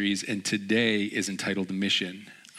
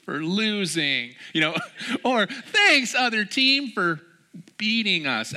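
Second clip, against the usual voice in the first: high, drawn-out sarcastic thank-you; a mocking, high and slow imitation of the thanks; 13 semitones higher, 6 dB louder, slower than usual. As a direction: send up the post-game thanks, sarcastic. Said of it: "I don't know why they do that".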